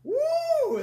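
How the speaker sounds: high, loud whoop; excited cheer. Real, not acted. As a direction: whoop with delight. Cheering during challenge.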